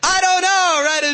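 high, loud obnoxious outburst. Acted. Teen answering parents after picking up a friend's habits, loud and obnoxious. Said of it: "you become loud and obnoxious".

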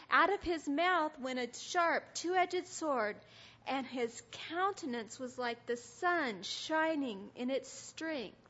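slow, weighty Bible reading with stretched stresses; scripture reading. A narrator's reading. Describing Jesus from Revelation, slow emphatic reading. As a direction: proclaim each phrase so they can picture it.

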